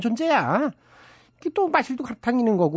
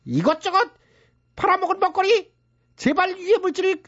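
higher, hammed-up prayer to God; mock prayer; 8 semitones higher than usual. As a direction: beg the heavens like a pompous preacher. Boss mock prays for sales. (과장된 가짜 기도조)

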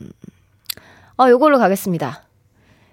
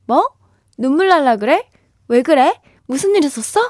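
mock-alarmed questions, pitched up at the ends; acted fussing; 4 semitones higher, faster than usual. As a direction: fuss over a friend, playfully overdone. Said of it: "호들갑 떨어줘야죠". (호들갑스럽게 놀란 척 묻기)

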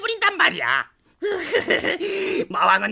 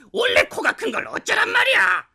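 indignant shout; 6 semitones higher than usual. Acted. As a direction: yell it back in a huff. Yorg protests about his nose.

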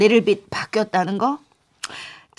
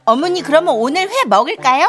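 higher, louder eager offer; anxious placating; 6 semitones higher, 4 dB louder than usual. Acted. Daughter-in-law to mother-in-law, higher and anxiously placating. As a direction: hurry to appease an offended elder.

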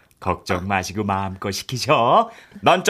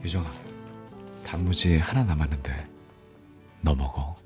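tender; 8 semitones lower, 7 dB quieter than usual. Acted. Caring senior offers food.

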